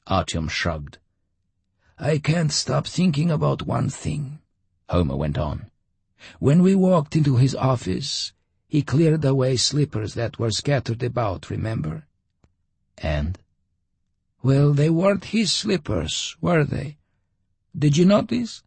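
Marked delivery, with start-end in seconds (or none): 2.0-4.4 put-on higher, gentler old traveler; lightly voiced old Homer; old man voice. A narrator's reading. 6.4-18.6 put-on higher, gentler old traveler; lightly voiced old Homer; old man voice. A narrator's reading.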